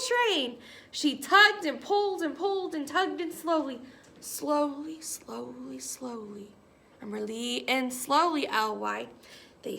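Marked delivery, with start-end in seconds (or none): none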